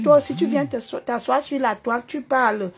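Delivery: normal talking voice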